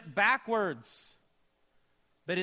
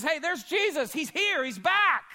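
excited discovery; 9 semitones higher, 6 dB louder than usual. Acted. John spotting Jesus on shore.

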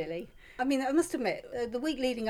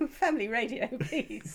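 mock-scolding through laughter; amused mock protest; laughing; 4 semitones higher than usual. Real, not acted. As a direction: pretend to tell her off while laughing. Laughing mock warning on air.

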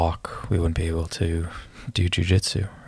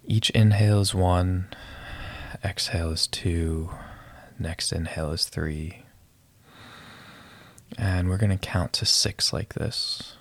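calm breath-counting guide voice; guided meditation. Acted.

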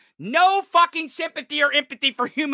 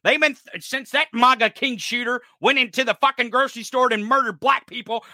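ordinary talk

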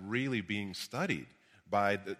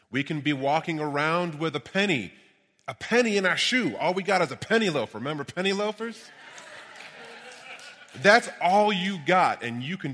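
booming motivational speech; 6 semitones higher, 6 dB louder than usual. Real, not acted. Building preaching climax.

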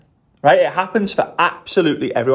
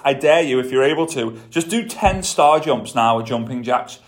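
normal talking voice

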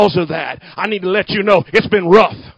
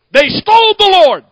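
higher, louder mock panic; mock panicked Mary; 10 semitones higher, 8 dB louder than usual. Preacher mocking Mary to congregation, louder mock panic. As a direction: ham up Mary's alarm, pitched up and loud. Voicing Mary's claim, mocking.

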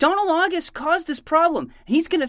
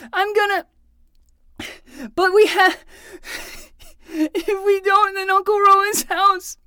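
tearful; 5 semitones higher, 3 dB louder than usual. Acted. Injured boy upset, crying.

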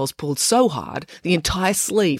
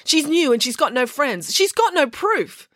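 accusing; 12 semitones higher than usual. A narrator's reading. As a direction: press the accusation, pitched high. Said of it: "accused Matilda".

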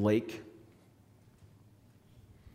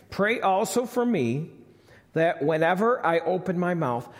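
raised, louder preaching voice; 5 semitones higher, 4 dB louder than usual. Acted. Preacher reading scripture to his congregation, fervent. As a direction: preach it out with fervour. Sermon preaching at full force.

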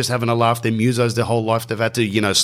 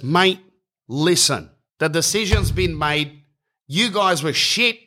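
higher, dismissive bloke on the phone; Mark's voice; 7 semitones higher, slower than usual. Retold or imitated. Organiser on the phone telling the band they were bad, higher and dismissive. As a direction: brush the caller off, mocking his band. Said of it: "He goes".

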